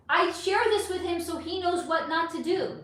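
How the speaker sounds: usual speaking voice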